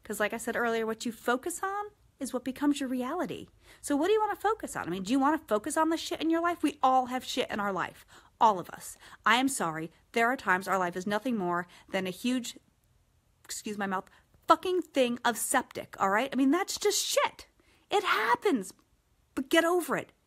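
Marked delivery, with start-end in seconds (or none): 3.8-6.6 higher and quick, pressing the point; urgent rhetorical push; fast emphatic challenge. Real, not acted. 16.3-20.1 higher, firm get-over-it push; no-nonsense pep talk; tough love. Real, not acted.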